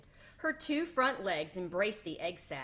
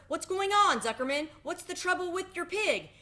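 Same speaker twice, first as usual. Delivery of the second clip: much higher, louder worried calls; alarmed announcer; 11 semitones higher, 5 dB louder than usual. A narrator's reading. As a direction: demand what happened to the pig. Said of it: "asked the loudspeaker".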